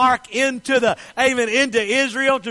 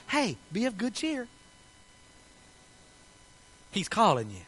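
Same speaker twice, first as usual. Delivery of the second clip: gentle encouragement; 9 dB quieter than usual. Acted. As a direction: coax him kindly, almost under your breath. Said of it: "are now telling him".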